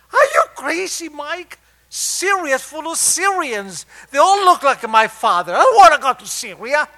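high, loud, exasperated protest; indignant outburst. Retold or imitated. Patient told to visit his father in Syria, indignant yelling. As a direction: yell it in high-pitched disbelief.

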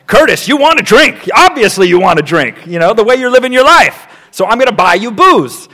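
loud, high-pitched telling-off; haranguing sponsor. Retold or imitated. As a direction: hammer it home at the reluctant sponsee. Acts out hard sponsor haranguing sponsee.

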